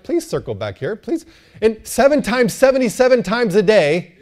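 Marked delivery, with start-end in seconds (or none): 0.0-1.3 soft, patient sat-nav prompts; kindly machine guide, softly repeating itself; gentle GPS voice. Acted. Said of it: "it very gently says".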